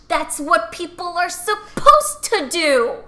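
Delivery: loud, drawn-out outburst of common sense; exasperated common sense. Acted. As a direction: spell out the obvious, loudly and slowly. Common sense scolding herself, emphatic.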